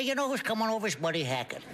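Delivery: high, put-on comic Buddy Hackett voice. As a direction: mimic how friends imitate him. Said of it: "they say".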